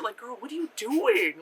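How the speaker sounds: ordinary talk, nothing marked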